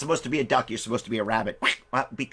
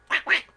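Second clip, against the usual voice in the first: two short quacks; imitation duck call. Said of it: "the duck quacked".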